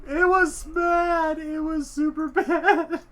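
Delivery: slow, high and loud through laughter; laughing, drawn out. Real, not acted. Recalling the fart, drawn out slowly while laughing. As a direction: stretch every word while cracking up.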